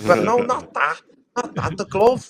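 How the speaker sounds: playful Scouse impression, pushed up high; Scouse accent. Telling a story about a Liverpool football fan, in a high mock Scouse accent. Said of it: "this is Scouse now".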